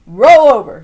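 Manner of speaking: loud barked order; commanding. A narrator's reading. Grandma ordering the boy, a loud command. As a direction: bark the command at the child. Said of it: "she'd command".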